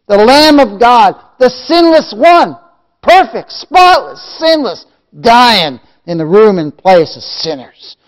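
loud, high, ringing proclamation; full-force preaching. Real, not acted. Preacher proclaiming the sinless Lamb to the congregation, full-force preaching. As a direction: build to a shouted peak on each title.

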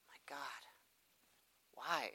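soft despairing whisper; whispered plea. Real, not acted. Preacher whispering to God after she refuses, dejected.

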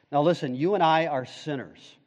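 ordinary talk

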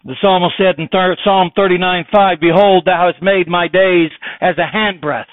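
full-voiced sermon delivery; preaching. Real, not acted. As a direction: preach it out to the congregation. Preacher at the pulpit.

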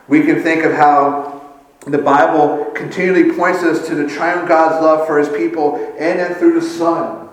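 normal talking voice

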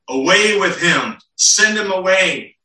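loud, high crowd demand; the crowd crying out to Pilate, loud. Retold or imitated. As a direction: shout it as the angry mob. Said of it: "they cried out".

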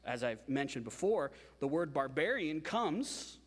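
ordinary talk

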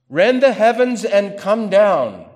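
high and loud prophetic cry; ringing scripture proclamation; loud declaiming. Acted. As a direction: call it out like the prophet.

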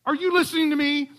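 an offended wife cutting off her husband; indignant wife. Retold or imitated. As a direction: snap back at him mid-excuse.

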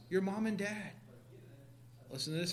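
normal talking voice

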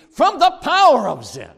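very loud, emphatic pulpit shout; preaching shout. Real, not acted. Preacher promising rescue from sin's power, shouted. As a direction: thunder the phrase at the congregation.

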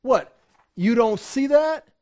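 imitating an incredulous rebuke; acted indignant scoff. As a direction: snort back at them, incredulous. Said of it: "he's snorting at them".

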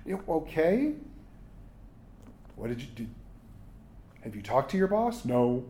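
normal talking voice

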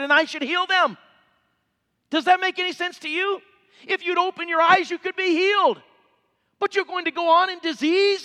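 high, forceful pulpit delivery; impassioned preacher, pitched high; fervent preaching. Real, not acted. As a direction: raise the voice and press the plea on the congregation.

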